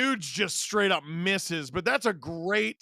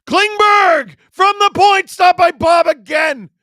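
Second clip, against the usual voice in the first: excited shout; 10 semitones higher, 12 dB louder than usual. Real, not acted. Live play-by-play, shot from point.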